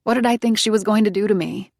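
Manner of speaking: usual speaking voice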